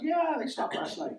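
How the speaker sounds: high, slow put-on voice of the organisers; lightly mock their offhand news; mock casual reply. Imagined reply from Toronto organisers.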